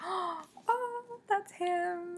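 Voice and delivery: high, breathy thrilled gasp of recognition; gasping realisation. Retold or imitated. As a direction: squeal it like you just spotted him. Gasps at recognising the love interest.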